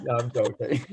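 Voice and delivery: laughing through the words. Real, not acted. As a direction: chuckle while taking back the joke. Takes back joke about French.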